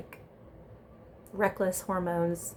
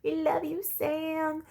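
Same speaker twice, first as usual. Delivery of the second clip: higher, mock call from a terrace; 10 semitones higher, slower than usual. Robin calling down to Sam from the terrace, higher playful call. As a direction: sing out a playful call upward. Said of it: "Robin yells down to Samraj".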